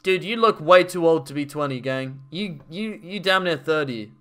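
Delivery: ordinary delivery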